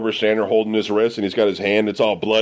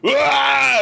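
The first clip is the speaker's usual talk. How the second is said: retching yell. Retold or imitated. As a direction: heave it out with a yell. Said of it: "Cease just leans forward and goes".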